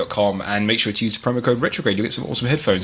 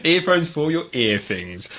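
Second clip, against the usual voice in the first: slow mock ad read; 3 semitones higher, slower than usual. Mock ad tagline.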